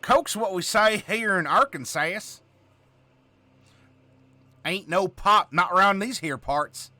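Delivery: put-on big Southern accent, drawn out; Southern drawl. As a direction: lay on a folksy Southern drawl. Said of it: "the Southern accent or the drawl".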